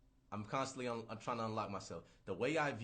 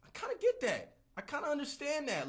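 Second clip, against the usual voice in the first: very high, falsetto-like musing; mock realization; 10 semitones higher than usual. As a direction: pitch it way up as a dawning thought. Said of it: "I'm like".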